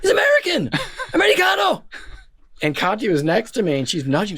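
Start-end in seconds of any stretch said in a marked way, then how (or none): 0.0-1.8 much higher, gleeful calling out; overjoyed yelling of the name and nation; excited yelling. Retold or imitated. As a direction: cheer the news to the crowd. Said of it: "I yelled out".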